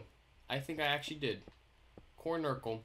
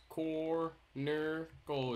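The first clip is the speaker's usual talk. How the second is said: usual speaking voice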